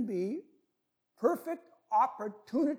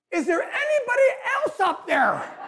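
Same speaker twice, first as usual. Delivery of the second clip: high, loud yell upward; frantic high cry for someone else; panicked tourist calling out; 12 semitones higher, 4 dB louder than usual. Acted. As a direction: call out desperately, pitched way up. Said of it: "And then the tourist says".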